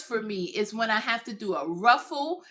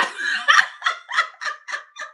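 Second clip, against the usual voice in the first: loud laugh; 4 dB louder than usual. Real, not acted. Laughing at broken fingers joke.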